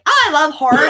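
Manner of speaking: put-on eager gushing; mimicked fangirl. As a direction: gush it, imitating a clueless horror fan. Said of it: "they'll be like".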